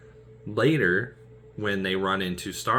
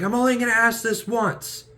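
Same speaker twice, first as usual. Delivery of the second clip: threatening demand; 14 semitones higher, 7 dB louder than usual. Retold or imitated. Drax-style demand where Gamora is, higher and louder. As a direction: demand an answer once only. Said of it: "He's like".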